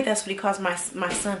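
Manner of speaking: normal talking voice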